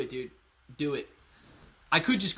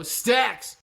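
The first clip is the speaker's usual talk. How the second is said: shout; 7 semitones higher, 6 dB louder than usual. Real, not acted. Shouting out a donor.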